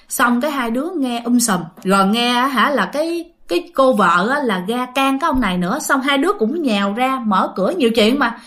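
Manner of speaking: usual speaking voice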